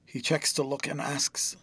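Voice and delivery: usual speaking voice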